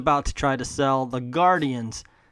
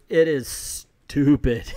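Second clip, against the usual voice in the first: slow emphatic; 3 dB louder, much slower than usual. Real, not acted. Emphatic verdict on the book.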